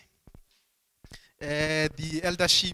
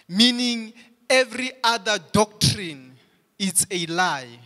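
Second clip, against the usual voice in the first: raised, driving pulpit delivery; fervent preaching; 3 semitones higher, 3 dB louder than usual. Acted. Pastor to congregation on exclusive truth: fervent preaching. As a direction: preach it out with mounting fire.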